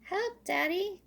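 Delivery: high cry for help. Acted. Little Squirrel stuck up the tree calls Daddy, high and scared. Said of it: "cried Little Squirrel".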